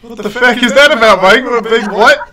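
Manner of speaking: shouted, incredulous question; loud, baffled outburst; loud disbelief. Real, not acted. Cohost reacts to sausage intro.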